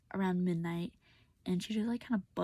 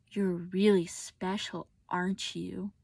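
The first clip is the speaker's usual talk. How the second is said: slow, drawn-out mock praise; retold sarcasm; 4 dB louder, much slower than usual. Mother confronting daughter after reading texts, sarcastic imitation. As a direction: imitate a scornful jab, dragging the words.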